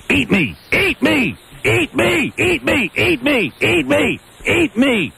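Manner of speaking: high, repeated jeering cry; mocking chant of eat me; gleeful taunting yell. Real, not acted. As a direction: chant it at him to rub it in.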